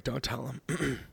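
hushed, conspiratorial; soft secret aside to listeners; whispered aside. Acted.

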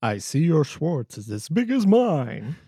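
slow, put-on villain voice; movie villain impression. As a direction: deliver it like a campy movie villain.

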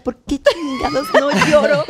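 high, slow, laughing lament; mock crying wail. Actress at home unable to cry on cue, hammed-up tearful wail. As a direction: wail in exasperation, half laughing. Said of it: "llegaba a la casa y lloraba".

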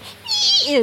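very high-pitched thin squeal; squealing crab sound; squeaky crab noise. Retold or imitated. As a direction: imitate a crab's high squeak. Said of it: "makes that squeaky noise".

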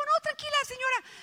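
voz muy aguda, apurada; apurada y conmovida. Acted. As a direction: responde en un hilo de voz agudísimo. Actúa su reacción conmovida ante la viejita. (voz agudita de quien se conmueve)

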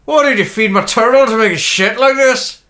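loud, high Irish accent. Shown as a demonstration. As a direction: exclaim it as a broad Irishman.

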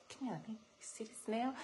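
quick, soft grandpa coaxing; hushed luring. Retold or imitated. Grandpa luring three grandkids up close, hushed. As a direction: draw the kids in with a hushed voice.

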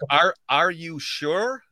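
slow American "sure" with full R; own American accent. Shown as a demonstration. Host shows his own version slowly, American accent. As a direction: draw out ARE and SURE in American. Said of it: "For me, it'd be like".

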